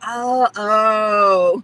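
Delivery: loud, drawn-out retold "oh"; dawning realization. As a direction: mimic a kid suddenly getting it. Son's sudden realization, acted out.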